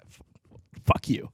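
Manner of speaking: normal talking voice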